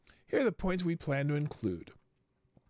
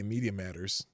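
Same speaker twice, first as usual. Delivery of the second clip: usual speaking voice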